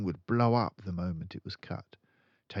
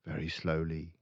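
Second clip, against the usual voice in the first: plain reading voice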